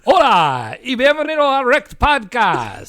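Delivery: high, loud Spanish show-host welcome; hammed-up Spanish announcer; showy Spanish. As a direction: belt out a playful greeting in Spanish. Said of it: "say a word in Spanish".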